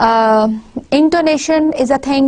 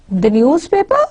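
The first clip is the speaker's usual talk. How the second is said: ordinary talk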